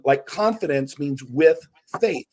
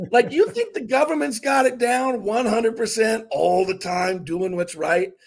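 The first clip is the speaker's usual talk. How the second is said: comedian's bit; 7 semitones higher than usual. Retold or imitated. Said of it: "he says".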